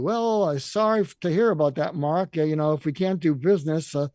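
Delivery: loud, curt mock brush-off, hurrying the man away; mock a transactional guy who wants to end the call; loud, impatient fake sympathy, then dismissal; dismissive brush-off. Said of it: "I'm not gonna slam you. I'm not gonna pivot to".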